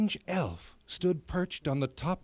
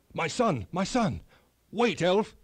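louder, higher desperate cries; desperate calling; 5 semitones higher, 4 dB louder than usual. A narrator's reading. King crying after the elf who took his son, desperate. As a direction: call after the son being taken away.

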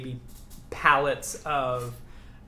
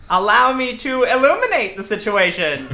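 high, loud grandiose announcement; theatrical villain; 7 semitones higher, 7 dB louder than usual. Acted. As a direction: play the showy foe revealing himself. Game master voices unseen taunter.

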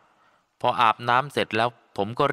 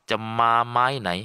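plain reading voice

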